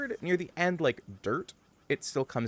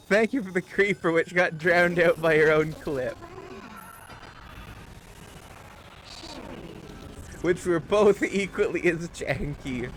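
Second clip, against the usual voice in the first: higher, louder laughing thanks; laughing thanks to a viewer for the clip; 6 semitones higher, 4 dB louder than usual. Real, not acted. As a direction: laugh through the thank-you.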